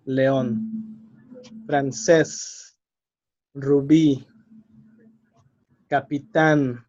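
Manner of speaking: león, Francés, rubí, capitán, each stressed on its ending; stress on last syllable. Shown as a demonstration. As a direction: say le-ÓN, fran-CÉS, ru-BÍ, capi-TÁN for "león, Francés, rubí, capitán". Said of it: "stress on the last syllable".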